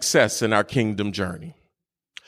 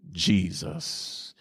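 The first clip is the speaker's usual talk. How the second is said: whispered name; 6 semitones lower, 8 dB quieter than usual. Acted. Sermon climax, dropped to breath.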